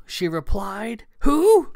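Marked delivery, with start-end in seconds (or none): 1.2-1.7 high, loud, indignant question; growing anger. A narrator's reading. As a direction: burst out in affronted disbelief. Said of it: "Kat was becoming angry".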